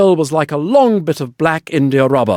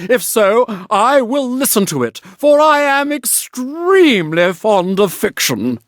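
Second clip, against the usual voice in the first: smug water rat; 6 semitones higher than usual. A narrator's reading.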